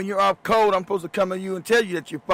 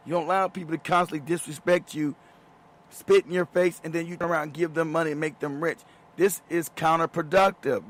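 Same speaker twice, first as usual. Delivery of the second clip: ordinary talk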